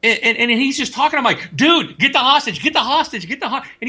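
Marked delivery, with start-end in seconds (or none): 1.6-3.6 high, pushing mock yell at a teammate; urgent yell. Gamer urging his teammate to grab the hostage, urgent. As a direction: hurry him, half laughing.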